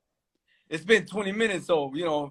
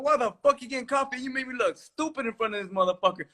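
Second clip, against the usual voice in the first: retold high scolding; 7 semitones higher, 5 dB quieter than usual. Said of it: "I go".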